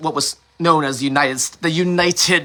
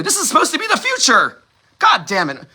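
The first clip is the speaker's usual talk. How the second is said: high-pitched exasperated outburst; pissed off; 10 semitones higher than usual. Real, not acted. Speaker venting that the future should be better, pissed off. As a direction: climb into an incredulous, angry cry. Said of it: "how pissed I am".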